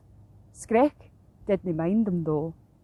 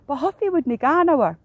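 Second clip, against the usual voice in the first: ordinary delivery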